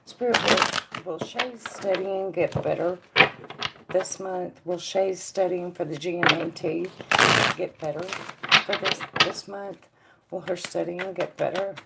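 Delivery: soft, hushed asking of the spirits; quiet question to Spirit. Acted. Psychic quietly asking Spirit about a viewer's studies, hushed. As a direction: murmur the question inward, as if to Spirit.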